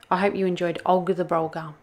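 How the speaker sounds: plain reading voice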